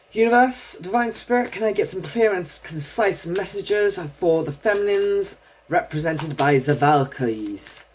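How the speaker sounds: put-on, uncertain Scandinavian-style accent, starting high; silly villain accent. Tarot reader asking the universe for messages, silly villain accent. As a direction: lilt it like a cartoon villain's accent. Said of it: "why I'm doing that accent".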